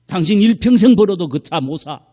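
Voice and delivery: loud, high scornful outburst; retold angry husband. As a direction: re-enact the husband berating his wife. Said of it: "아내한테 한 말". (아내에게 버럭 화내는 남편 흉내)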